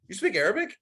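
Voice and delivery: high, amazed "you speak Arabic?"; a girl caught off guard, pitch leaping up; imitating her astonished high voice; surprised question.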